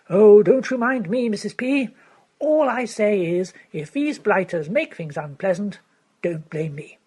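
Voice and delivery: put-on loud, slow, offhand young toff; Freddy voiced. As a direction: brush off the old lady, loudly.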